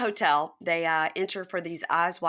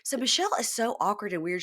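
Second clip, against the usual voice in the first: ordinary talk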